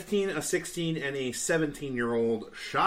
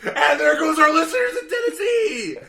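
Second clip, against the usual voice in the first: laughing shout; 13 dB louder, slower than usual. Real, not acted. Laughing joke about losing listeners.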